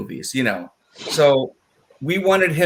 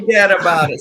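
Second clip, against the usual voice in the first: wise guy catchphrase; 5 semitones higher, 3 dB louder, faster than usual. Retold or imitated.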